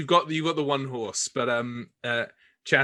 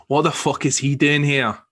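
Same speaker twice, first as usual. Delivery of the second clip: louder, furious demand; angry; 3 semitones higher, 8 dB louder than usual. Acted. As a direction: challenge the intruder, axe in hand. Carter stands with an axe.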